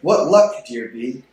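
ordinary talk, nothing marked

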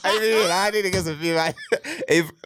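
can't hold back the laugh. Real, not acted. Arguing about Magic Johnson with his cohost, laughing while talking.

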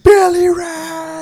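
high drawn-out shout; anguished shout. Retold or imitated. Quoting dying brother scene, drawn-out shout. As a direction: cry the name out slowly. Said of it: "He's like".

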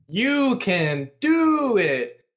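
loud, high, drawn-out fake encouragement; sarcastic cheer. Shown as a demonstration. Worker naming the shop's coded insults, sarcastic cheer. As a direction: stretch it out as mocking encouragement. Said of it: "in the most fucking sarcastic way possible".

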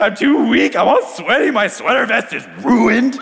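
high, tearful wail; weeping. Retold or imitated. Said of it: "start weeping".

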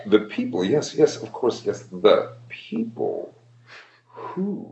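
halting, slow, stop-and-start musing; never finish the sentence, like Goldblum; Jeff Goldblum impression. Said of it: "listening to Jeff Goldblum".

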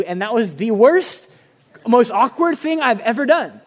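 half-laughing complaint to God; exasperated laugh at the awkwardness; laughing exasperation. Retold or imitated.

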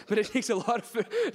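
amused confession, words breaking into laughter; laughing. Real, not acted. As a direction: laugh the words out as you admit it. Laughing at own admission.

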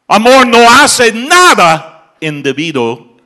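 preacher roaring the climax; shouted preaching. Acted. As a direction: bellow each word to the back pews. Repeats the rule at a climax.

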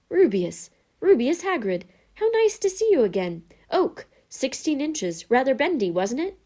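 high, loud, exclaiming welcome; delighted greeting. Acted. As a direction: greet an old acquaintance with sudden delight. Wandmaker greets an old acquaintance delightedly.

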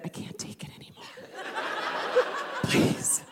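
whispered, fast and desperate; begging whisper. Retold or imitated. Daughter begging her mother, fast desperate whisper. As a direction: beg under your breath.

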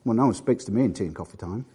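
low, soft grumbling complaint; a lightly voiced moaning churchgoer; mock grumbling congregant.

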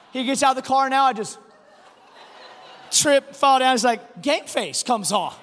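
ordinary talk

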